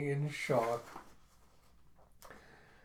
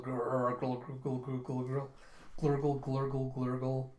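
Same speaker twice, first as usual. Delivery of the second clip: imitated gurgling; slower than usual. Hungry man's belly answering, imitated gurgling. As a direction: voice a repeated gurgling rumble. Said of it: "As his camera goes".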